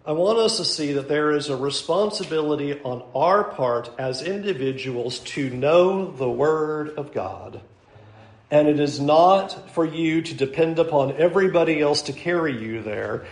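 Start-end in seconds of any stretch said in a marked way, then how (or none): none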